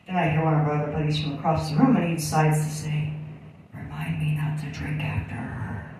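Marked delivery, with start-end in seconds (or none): 3.7-5.9 hushed unvoiced aside; whisper. Buddy whispering a teasing remark across the room. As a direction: mutter it low like a cheeky tease.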